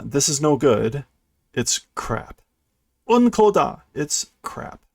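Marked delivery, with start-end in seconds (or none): 3.1-3.8 character's outburst. Retold or imitated. Voicing angry game character.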